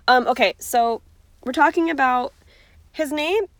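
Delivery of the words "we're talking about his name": hiccuping mid-sentence; speaking through hiccups. Real, not acted. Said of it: "Sorry, I have the hiccups".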